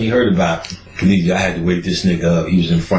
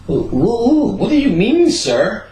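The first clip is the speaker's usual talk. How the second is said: mock shocked woman; 13 semitones higher than usual. Mock woman answering a creep on the train, high prissy voice. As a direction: play a scandalised lady.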